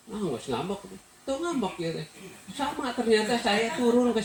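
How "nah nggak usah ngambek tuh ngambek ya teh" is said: mock snappy mother, higher; imitating sharp mother. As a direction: play the blunt mother who sounds angry but isn't. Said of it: "tapi kalau ngomong gimana, ya, kayak".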